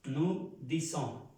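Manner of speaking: "Nous disons" drawn out slowly, "nu dizon"; teacher slowly models "Nous disons" for the class; slow demo. Shown as a demonstration. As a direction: spell it out slowly, syllable by syllable. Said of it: "Aquí me faltó una letra".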